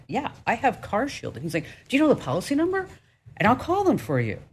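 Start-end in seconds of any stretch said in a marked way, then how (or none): 0.1-1.3 higher, pleased and a little proud; proud confirmation. Retold or imitated. Telling Roger she has CarShield, pleased. 1.9-4.4 much higher and fast, eager; eager offer. Retold or imitated. Roger eagerly offering to call CarShield, fast and high.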